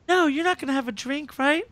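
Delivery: pitched high, pleading lightly; overacted promo plea; hammed-up concerned friend. Parodying a TV promo, a friend checks he won't drink, hammed up.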